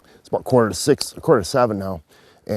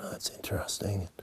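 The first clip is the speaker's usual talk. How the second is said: hushed unvoiced whisper; whispering while hiding; 18 dB quieter than usual. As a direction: breathe it so the deer won't hear. Hunter thinking while hiding.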